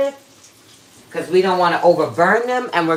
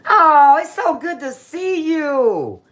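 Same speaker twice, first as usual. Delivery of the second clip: gushing welcome; 7 semitones higher, slower than usual. Real, not acted. Greeting a returning viewer.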